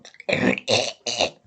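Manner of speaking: loud wet cough; real cough. Real, not acted. Sick vlogger coughing, loud and real. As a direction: cough it out mid-sentence. Coughing through a head cold.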